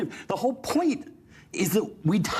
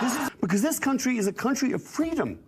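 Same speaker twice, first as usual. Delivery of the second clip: higher, impassioned protest; worked up; 6 semitones higher, faster than usual. Real, not acted. Panelist insisting on a country of freedom, worked up. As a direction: insist on it, too heated to calm down. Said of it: "Yeah, yeah, calm down".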